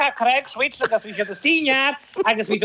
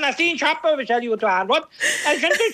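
usual speaking voice

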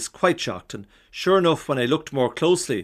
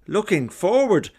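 louder and higher, near exploding; louder, exasperated; 8 semitones higher, 3 dB louder than usual. Retold or imitated. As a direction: push the voice up as if about to burst. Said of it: "with increasing decibels each time".

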